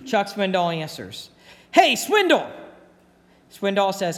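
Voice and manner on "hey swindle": cheery greeting. Retold or imitated. Old bunkmate calling on phone.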